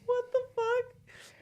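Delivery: high, slow, drawn-out what the fuck; a retold jaw-drop of shock; mock disbelief. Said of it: "And I was just like".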